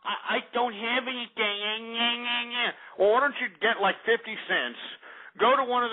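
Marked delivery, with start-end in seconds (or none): 0.1-2.7 slow, drawn-out mock whimper; mock whiny victim. Mocking a heat victim who has no water, slow and whimpering. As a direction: play the helpless complainer. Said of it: "and let's say".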